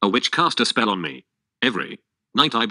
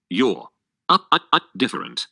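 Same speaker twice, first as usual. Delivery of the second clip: stammering shock; 2 semitones higher than usual. Acted.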